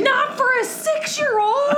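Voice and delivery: very high, exasperated objection; shrieked protest. Real, not acted. Mom objecting to child watching party movie, high-pitched protest.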